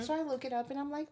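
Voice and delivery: ordinary talk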